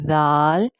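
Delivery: زال with a soft "dh", drawn out; long, soft-tongued زال; soft letter sound. Shown as a demonstration. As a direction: say زال gently as "dhaal". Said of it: "زال کو بھی softly پڑھا جاتا ہے".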